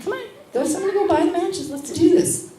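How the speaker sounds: high, loud, pushing them on; defiant dare. Retold or imitated. Dares the angry young men to burn the clinic, high and loud. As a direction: throw down the challenge, high and loud.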